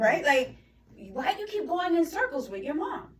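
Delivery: imitating a fed-up husband, high and loud; exasperated scolding. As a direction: demand an answer, loud mock exasperation. Husband scolds son for arguing with mom.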